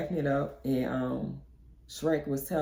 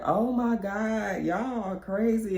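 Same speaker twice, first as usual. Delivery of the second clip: laughing reply; 6 semitones higher, slower than usual. Retold or imitated. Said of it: "they bust out laughing and was like".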